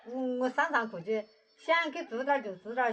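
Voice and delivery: ordinary delivery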